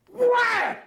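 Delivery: high sharp wham of the kick; kick sound effect. Acted. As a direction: make the kick's impact noise. Farmer kicks guy in groin.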